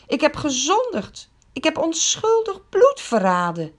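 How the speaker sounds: mild narrated outcry of guilt; Judas crying out. A narrator's reading. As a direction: cry out in despair. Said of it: "hij schreeuwt door de tempel".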